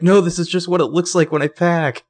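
hammed-up panic denial; mock panicked Spencer. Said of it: "Nailed it".

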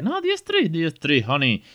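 high burst dropping back toward normal; hammed-up insistent parent correction; exaggerated correction. Parent correcting child's Spanish answer, exaggerated. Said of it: "Lo he exagerado mucho".

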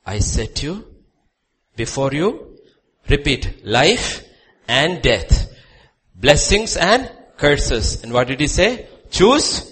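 high, loud shouted sermon; fiery pulpit proclamation; preaching at full force. Real, not acted. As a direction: thunder the psalm to the congregation.

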